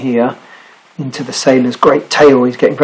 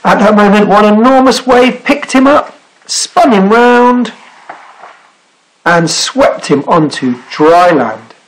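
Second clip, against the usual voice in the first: higher, building excitement, then settling slowly; story narration; 8 semitones higher, 3 dB louder, slower than usual. Acted. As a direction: sweep listeners through the climax. Reads climax of story aloud.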